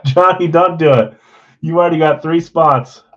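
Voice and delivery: loud, high, laughing protest at Johnny; half-laughing, beg him to stop; a playful loud don't-do-it; laughing plea. Real, not acted.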